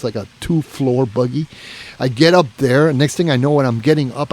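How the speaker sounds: ordinary talk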